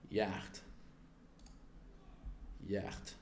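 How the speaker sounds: Yacht as a low, soft "yakht"; quietly retry Yacht with short "a" and throaty "ch"; trying out pronunciation. Shown as a demonstration. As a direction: softly puzzle over Yacht, said "yakht". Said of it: "How do you pronounce that?".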